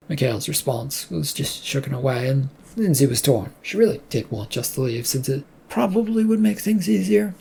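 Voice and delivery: ordinary delivery, nothing marked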